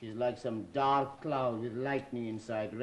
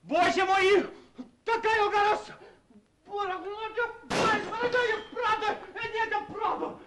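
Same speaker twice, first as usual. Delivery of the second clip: roaring, much higher and louder in rage; furious outburst; 6 dB louder than usual. Acted. Prince bellowing at the room in sheer rage. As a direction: rant at the heavens in fury.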